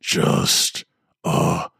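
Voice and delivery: mock the victim, sliding between child and demon; mocking girl-demon voice. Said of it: "the voice mocked, going back and forth".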